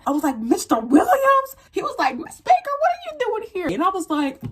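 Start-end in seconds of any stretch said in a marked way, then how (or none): 0.4-1.6 stunned recognition. Retold or imitated. Recognizing teacher. 2.2-3.7 surprised teacher voice. Retold or imitated. Teacher greets her.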